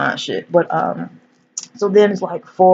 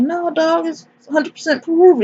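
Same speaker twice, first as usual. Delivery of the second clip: high-pitched imitation of a reassuring buddy; mock reassurance; 12 semitones higher than usual. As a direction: play the boss brushing off the panic, high-pitched. Boss reassures the panicking man.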